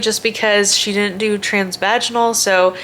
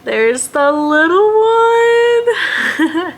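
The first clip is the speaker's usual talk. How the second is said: mom-to-be cooing over the ultrasound photo; 11 semitones higher, 5 dB louder, slower than usual. Real, not acted. Showing first ultrasound photo.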